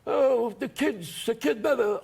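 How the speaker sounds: high, slow, menacing put-on voice; mock mobster. Armed gangsters at ringside judging the kid, mock mobster voice. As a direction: mimic a gangster sizing up the act.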